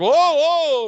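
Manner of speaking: loud, high alarmed whoa; mock terror, voice jumping up; freaking out. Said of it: "they both fucking freak out".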